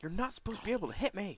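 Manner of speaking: high-pitched indignant complaint; indignant protest. Real, not acted. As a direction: protest loudly at the unfairness. Protests being hit in game.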